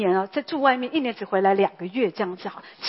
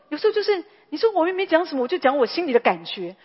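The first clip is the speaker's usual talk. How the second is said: pitched up high and quick, imitating a self-justifying listener; mock defensive protest; 6 semitones higher than usual. Preacher imitating a defensive churchgoer, high and quick. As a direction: play the one who insists they said nothing wrong.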